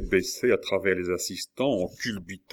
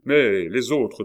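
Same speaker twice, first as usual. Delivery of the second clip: impatient; 4 semitones higher, 7 dB louder, slower than usual. A narrator's reading. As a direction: question pressée, plus forte. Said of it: "demanda impatiemment le duc". (le duc presse l'Allemand, impatient)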